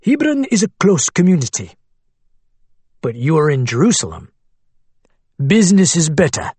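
put-on Arabic accent. A narrator's reading. Abu Hamad's voice.

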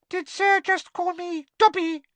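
very high, tiny squeaking voice; curious elf squeak. Acted. House elf asking Harry about Dobby, curious squeak. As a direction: peep it out like a little house elf. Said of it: "squeaked the elf curiously".

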